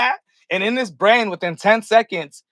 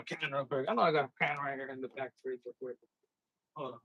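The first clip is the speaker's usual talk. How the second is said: fast, far-off and much softer; away from mic; 8 semitones lower, 17 dB quieter, faster than usual. Real, not acted. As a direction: call it from across the room. Walks off to fridge.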